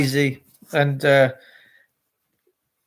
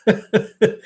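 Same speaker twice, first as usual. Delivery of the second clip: short laugh; 5 semitones higher than usual. Real, not acted. Laughing after punchline "my dad.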